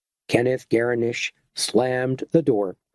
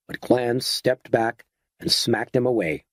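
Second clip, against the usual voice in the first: ordinary delivery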